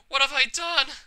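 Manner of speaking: horrified. Acted. Horror after self-harm.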